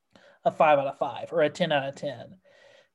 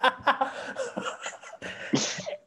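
real laugh; 9 semitones higher, 6 dB quieter than usual. Real, not acted. Reacting to a funny idea.

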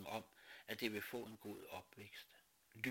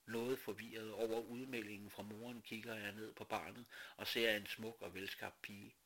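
ordinary delivery

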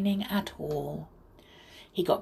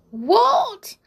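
high, louder outraged exclamation; indignant cry; 6 semitones higher, 4 dB louder than usual. A narrator's reading. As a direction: cry out in storybook outrage at the request. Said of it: "cried the sailor doll".

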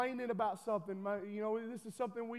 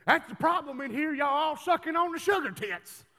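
louder and higher fire-and-brimstone preacher quote; dad preaching loudly to the church; preaching father; 5 semitones higher, 8 dB louder than usual. Retold or imitated. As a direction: call the congregation out like an old-school preacher. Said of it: "he said".

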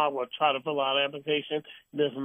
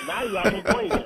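laughing punchline; 14 semitones higher, faster than usual. Real, not acted.

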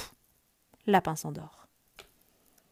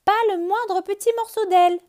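calling down; 12 semitones higher, 12 dB louder than usual. Acted. Bird calls down from sky.

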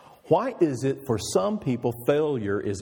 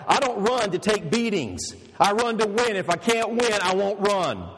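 retold shout; 7 semitones higher, 3 dB louder than usual. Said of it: "he begins to shout".

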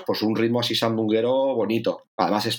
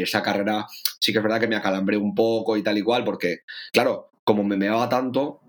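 normal talking voice

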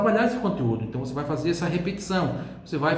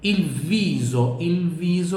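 il viso, drawn out slowly; teacher models il viso slowly for repetition; slow model word; 3 semitones higher, much slower than usual. Shown as a demonstration. Teacher slowly models il viso.

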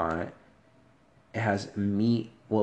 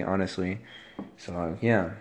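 usual speaking voice

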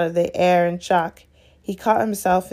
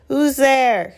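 high, loud demand; sudden high-pitched challenge from a sprite; 7 semitones higher, 5 dB louder than usual. A narrator's reading. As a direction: call out sharply into the dark. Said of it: "he said suddenly".

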